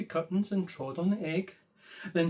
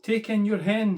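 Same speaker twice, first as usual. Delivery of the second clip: higher, louder confident advice; wise old man; 5 semitones higher, 7 dB louder than usual. Acted. Wise old man telling the lady to take her hen. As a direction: announce the plan brightly.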